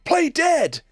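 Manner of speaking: high alarmed cry; panicked camper. Retold or imitated. Camper spotting a bear, alarmed high cry. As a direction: raise the alarm about a bear. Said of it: "turns around and goes".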